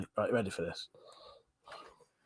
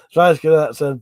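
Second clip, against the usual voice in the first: ordinary delivery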